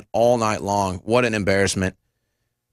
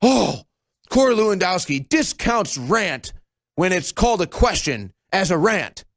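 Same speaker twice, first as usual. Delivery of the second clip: higher, slow mock news gasp; mock dramatic headline, higher; mock outraged headline; 7 semitones higher than usual. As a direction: sneer the headline like shocked press. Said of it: "And then it's like".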